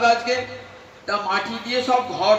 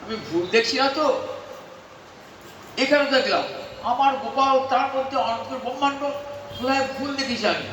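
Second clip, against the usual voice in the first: astonished mother; 4 semitones higher than usual. Acted. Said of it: "সঙ্গে সঙ্গে মা ভাবছে".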